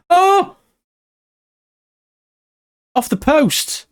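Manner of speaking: sudden very high cry as it hits the post; jolted, caught off guard; startled cry. Real, not acted. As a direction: shot rattles the post, startled.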